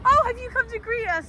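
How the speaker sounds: high, sing-song surprised question; mock surprised greeting. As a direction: chirp it out all innocent. Quoting herself to hotel staff.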